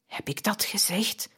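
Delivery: puzzled whisper; soft, amazed whisper of a dying man; wondering. A narrator's reading. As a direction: whisper it, bewildered. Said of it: "antwoordde Jan verwonderd".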